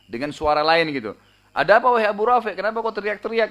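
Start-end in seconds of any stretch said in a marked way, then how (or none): 1.5-3.5 changed voice, higher and louder, quick; disguised voice. Retold or imitated. Abdullah fakes a guard's voice to fool Abu Rafi, disguised and higher. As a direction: pretend to be a concerned guard rushing in. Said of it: "saya ubah suara saya lagi".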